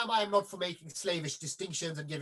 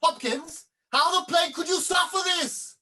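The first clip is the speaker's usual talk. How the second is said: higher and louder, scolding the prompter; an author fuming at his mangled play; exasperated outburst; 10 semitones higher, 6 dB louder than usual. Acted. Author sees his play cut.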